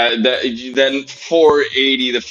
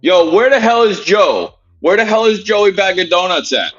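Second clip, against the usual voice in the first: calling out; 9 semitones higher than usual. Real, not acted. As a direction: call out across the room for Joey, pitched high. Calling out for missing friend.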